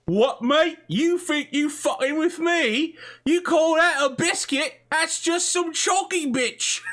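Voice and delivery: very high, loud mock British-Australian yob; hammed-up British-Australian accent. Shown as a demonstration. Game master showing off a bad accent to players, hammed-up high British-Australian. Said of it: "doing my Australian or even my British".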